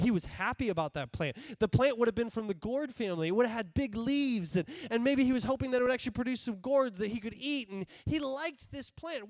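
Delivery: ordinary talk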